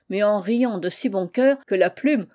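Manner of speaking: ordinary delivery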